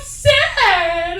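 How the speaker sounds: calling everybody after he left, whining; whiny mock sadness. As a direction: ham up a pitiful sob-story voice. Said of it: "calling everybody".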